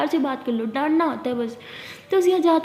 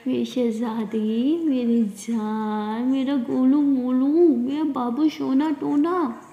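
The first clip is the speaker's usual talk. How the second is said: teasing her upset friend with slow doting pet names; sweet coaxing; much slower than usual. Acted. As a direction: coo the pet names slowly.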